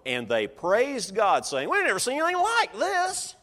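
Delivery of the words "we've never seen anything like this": high-pitched, astonished exclamation; amazed crowd. Acted. Crowd praising God after the healing, amazed. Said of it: "This amazed everyone".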